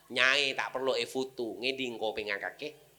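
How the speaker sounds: ordinary talk, nothing marked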